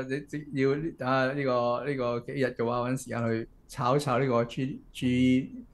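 ordinary talk

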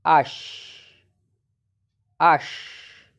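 ash with a throaty back-of-mouth ch; demonstrated ash. Shown as a demonstration. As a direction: say ash slowly, the ch rasped in the throat. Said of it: "E C H sound is".

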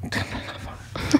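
hushed whisper for emphasis. Real, not acted. Actor confiding how many jobs were turned down, whispered. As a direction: repeat it under your breath, all whisper.

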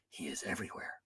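very hushed, low whisper; low whispered dread. A narrator's reading. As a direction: drop right down to a secretive hush. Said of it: "she whispered".

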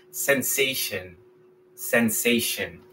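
sensation slow and soft, shun ending; correct pronunciation. Shown as a demonstration. Teacher modeling English for students, slow and soft. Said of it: "Vamos a decir".

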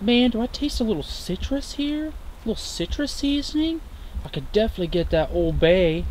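high, soft voiced raccoon. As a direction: speak for the raccoon, quietly amused. Raccoon voiced tasting the shrimp.

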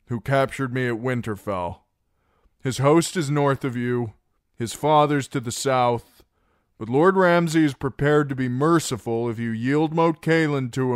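high, loud formal ultimatum; loud commanding terms. Acted. Reek delivering Ramsay's terms to the Ironborn, loud and high. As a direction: proclaim it to the whole hall.

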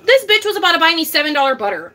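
usual speaking voice

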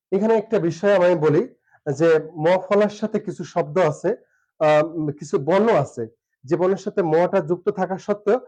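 ordinary talk

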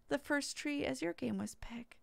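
breathy muttered whisper. Real, not acted. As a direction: drop to a voiceless mumble. Muttered slip while reading thanks.

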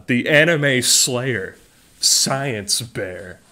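slow, grand hype announcement; announcer voice. Pretend. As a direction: introduce the champion slowly. Announces Science Bear grandly.

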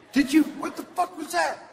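high, loud spooked voice; startled local. Pretend. A golfer hearing the ghost in the night, high startled cry. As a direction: jump at the sound.

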